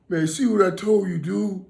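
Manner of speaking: high, loud, lively imitation of a buddy; excited high-pitched friend impression; recovered friend's voice. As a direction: crow it high and proud. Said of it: "he was sounding like this".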